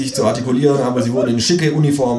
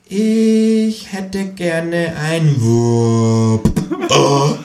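very slow, high, each word spelled out through a laugh; repeated for dummies; 8 semitones higher, 4 dB louder, much slower than usual. Acted. Kangaroo repeats the order very slowly for the cashier. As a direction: say it painfully slowly as if to an idiot. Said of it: "wiederholt das Känguru für Dumme".

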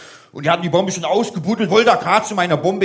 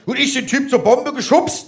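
usual speaking voice

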